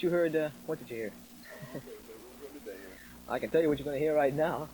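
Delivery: normal talking voice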